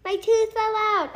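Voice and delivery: high, fast, alarmed cry; panicked screech. Acted. Emily shocked her tooth flew out, high and fast. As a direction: wail in shock. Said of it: "screeched Emily".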